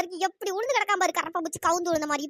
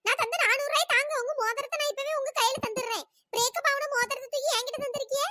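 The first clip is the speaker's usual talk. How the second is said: higher, angry complaint; raised voice; 5 semitones higher than usual. Acted. Girl angrily accuses the older woman of cheating her with the ring. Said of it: "voice எகுறுது".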